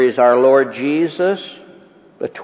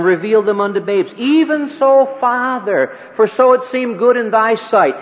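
higher and louder, building fervent preaching; preaching climax; 8 semitones higher, 4 dB louder than usual. Acted. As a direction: lift the prayer into a ringing climax.

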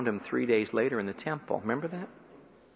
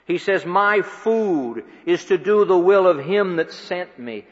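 preaching at full force; 7 semitones higher, 6 dB louder than usual. Acted. Preacher to the congregation, building to the hour of the cross, forceful and climbing. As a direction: raise it higher and louder, pressing home each line.

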